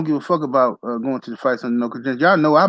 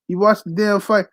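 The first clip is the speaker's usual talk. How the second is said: ordinary delivery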